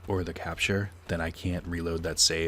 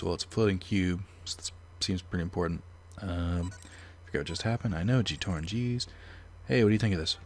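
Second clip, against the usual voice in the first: ordinary delivery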